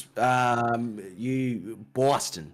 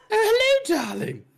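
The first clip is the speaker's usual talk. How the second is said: put-on very high, loud, laughing old English nanny voice; Mrs Doubtfire; 8 dB louder than usual. Doing Mrs Doubtfire's voice when a friend suggests it. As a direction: hoot it like a fussy granny in drag. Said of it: "Think of Missus Doubtfire".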